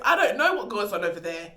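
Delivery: ordinary talk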